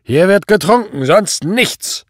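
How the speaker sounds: loud, high, overbearing command; called-out order. A narrator's reading. Drunken boss insists his officer drink, loud and commanding. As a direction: bellow it as an order. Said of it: "rief Prall".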